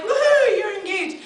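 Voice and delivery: high whoop that drops off, laughing; half-hearted hooray; mock cheering. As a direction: cheer like a dutiful friend. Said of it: "everyone would be like".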